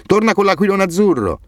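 grido acuto verso chi si allontana; shout after him. A narrator's reading. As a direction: gridagli dietro da lontano. (Amir grida a Hassan che corre: richiamo acuto)

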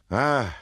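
high, sudden "ah!"; knife grinder's high exclamation; cried-out "ah". A narrator's reading. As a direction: cry out a single open "ah". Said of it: "l'arrotino gridò".

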